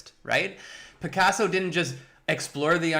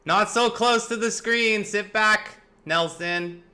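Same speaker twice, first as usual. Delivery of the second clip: parent calling out; 7 semitones higher, 3 dB louder, slower than usual. Real, not acted. Parent calls out to child watching TV.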